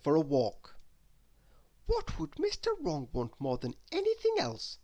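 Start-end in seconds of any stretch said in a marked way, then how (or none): 1.8-4.8 wondering aloud. Acted. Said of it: "she asked".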